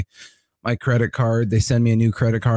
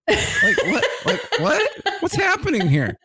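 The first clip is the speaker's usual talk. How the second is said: laughing through it, pitch higher; can't believe it, cracking up; laughing disbelief. Real, not acted. Laughing at absurd phone reply.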